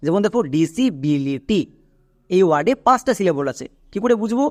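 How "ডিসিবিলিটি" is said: Disability slowly, in five separate beats; slow teaching model of disability; syllable by syllable. Shown as a demonstration. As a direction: sound out each syllable of disability. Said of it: "খুব ভালো করে দেখবা".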